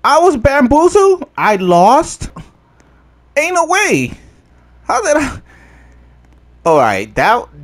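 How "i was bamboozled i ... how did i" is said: high-pitched, loud disbelief; incredulous outburst. Real, not acted. Player shocked at losing the race, incredulous. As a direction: cry out in outraged bafflement, voice shooting up.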